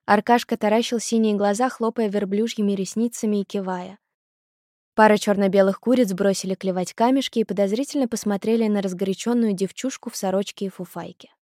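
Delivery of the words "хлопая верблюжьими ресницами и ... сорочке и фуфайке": usual speaking voice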